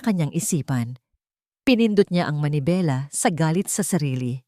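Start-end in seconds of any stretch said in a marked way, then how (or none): none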